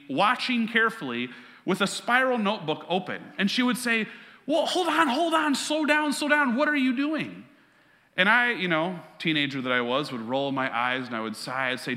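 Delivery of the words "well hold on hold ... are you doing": much higher, hammed-up flustered mom voice; imitating anxious mom. Mom stopping son at computer.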